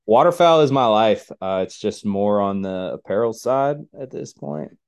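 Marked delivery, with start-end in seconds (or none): 0.1-1.3 mock proud declaration. Joking enthusiastic declaration.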